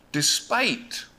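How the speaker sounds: ordinary talk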